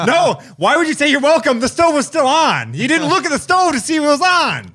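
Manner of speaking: loud, high exasperated rant. Real, not acted. Exasperated rant about the stove.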